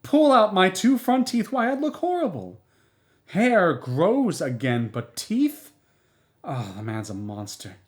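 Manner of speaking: high, loud indignant tirade; indignant, high-pitched outburst; furious outrage. Acted. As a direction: pour out your outrage to the neighbour. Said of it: "She was furious".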